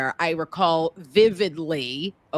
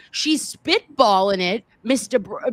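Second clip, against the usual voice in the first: ordinary talk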